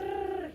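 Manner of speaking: long tongue-trilled R; rolled R trill. Shown as a demonstration. Showing the learner how, long rolled R. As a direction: trill the R on its own. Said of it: "Can you roll your arse?".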